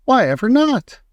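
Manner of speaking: high, loud indignant question; astonished. Acted. Wonka baffled by the refusal, high surprised outcry. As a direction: demand it, pitched right up.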